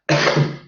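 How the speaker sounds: short rough cough. Real, not acted. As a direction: clear the throat with a cough. Coughs before excusing his voice.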